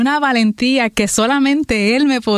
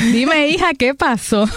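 higher-pitched eager pastor imitated, amused; straight to the point; 6 semitones higher than usual. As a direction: imitate her skipping the hello, pitched up. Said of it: "No me dijo, Hola, ¿cómo estás?".

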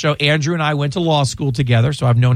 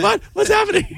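high startled yelp; mock surprise, pitch jumping high; startled what. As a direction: mimic a startled colleague jumping up. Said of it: "Mike was like".

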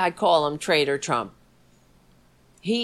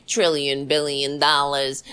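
low, monotone mock boasting; lower, droning con-man imitation; 7 semitones lower than usual. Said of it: "He's a con man".

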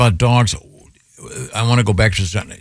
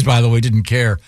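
ordinary delivery

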